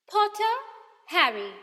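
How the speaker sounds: loud, high roll call; teacher reading out a list loudly; calling out names. A narrator's reading. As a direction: call the name across the hall. Name called out.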